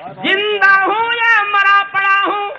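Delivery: high, loud, slow poetic cry; ringing, stretched declamation; anguished verse. Acted. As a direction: cry out the question to the hall. Anguished question in stage poem.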